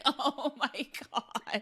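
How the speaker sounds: soft, slow, deflated oh my God; sinking at the news; stunned dismay. Retold or imitated. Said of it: "I was like".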